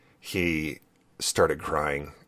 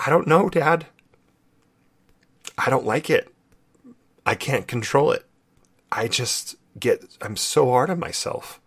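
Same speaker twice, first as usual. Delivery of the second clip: higher-pitched, voicing a frustrated boy; upset son's voice; 7 semitones higher than usual. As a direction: plead it like a kid who can't help it. Son's tearful quote retold.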